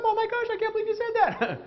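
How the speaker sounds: very high, rushed flight-response voice; high-pitched panic. Shown as a demonstration. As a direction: squeal it fast, in mock alarm. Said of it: "The pitch of voice goes up".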